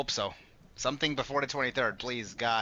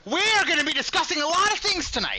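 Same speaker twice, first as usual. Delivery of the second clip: loud sharp voice; 14 semitones higher, 4 dB louder than usual. Acted. Continues hosting the show intro.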